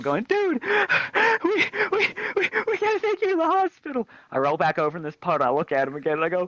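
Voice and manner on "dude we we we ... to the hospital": high, stammering mock panic; panicked buddy. Panicked buddy urging his injured friend to hospital, high and stammering. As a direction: stammer it out in a squeaky frantic pitch.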